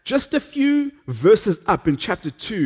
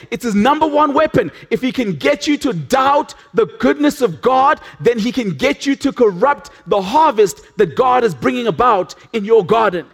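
preaching at full force; 4 semitones higher than usual. Acted.

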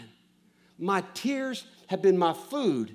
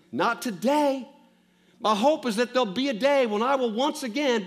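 raised, urgent preaching voice, voicing the psalmist's pain; impassioned preaching; 7 semitones higher, 4 dB louder than usual. Pastor speaking as the grieving psalmist to the congregation, raised and urgent. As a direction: pour it out as the hurting psalmist.

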